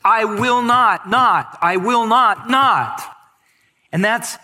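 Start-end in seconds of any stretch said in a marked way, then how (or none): 0.1-3.0 "not" hammered each time, higher and louder; double negative stressed. Shown as a demonstration. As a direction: punch every "not" like a drumbeat. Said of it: "There's double negatives".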